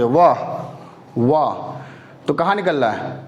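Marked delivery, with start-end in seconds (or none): 1.2-1.5 व said low and clear, teeth on lip; demo sound. Shown as a demonstration. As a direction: model व for students. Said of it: "व को उच्चारित करिए".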